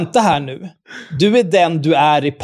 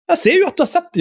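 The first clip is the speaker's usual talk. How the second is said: insistent repeat; 12 semitones higher, faster than usual. Real, not acted.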